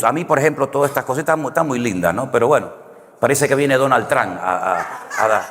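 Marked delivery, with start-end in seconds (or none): none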